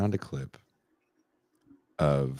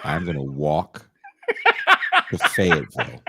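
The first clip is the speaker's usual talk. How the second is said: laughing threat; 3 dB louder than usual. Real, not acted. Joking threat while laughing.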